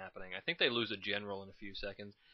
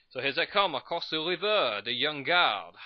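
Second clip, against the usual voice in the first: put-on higher, louder slip into a Spanish accent; 5 semitones higher, 5 dB louder than usual. Narrating the Young Guard crossing, in a Spanish accent. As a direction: drift into a jokey Spanish accent. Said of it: "accidentally slipped into a Spanish accent".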